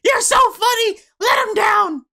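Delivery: very high, loud cartoon wife voice; Marge Simpson. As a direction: scold like a cartoon mother breaking up a fight. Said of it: "Marge has to come over and go".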